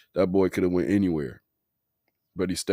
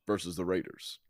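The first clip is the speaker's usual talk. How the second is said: whispered aside; 2 semitones lower, 8 dB quieter than usual. Real, not acted. Naming matchup as a throwaway aside.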